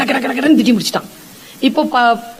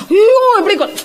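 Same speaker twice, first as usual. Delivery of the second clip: shocked; 11 semitones higher than usual. Retold or imitated. Arjuna shocked at order to kill.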